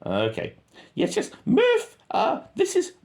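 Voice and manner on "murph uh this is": high radio call. Retold or imitated.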